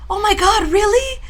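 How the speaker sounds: very high, loud squeal of disbelief; excited grandmother. Retold or imitated. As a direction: imitate an overjoyed grandma's astonished question. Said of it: "she was so excited".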